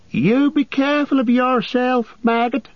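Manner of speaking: high call after her husband. A narrator's reading. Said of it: "she called".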